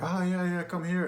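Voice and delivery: high-pitched imitation of a mother; beckoning mother. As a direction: call him over in a high mock-mom voice. Voices mom beckoning him over.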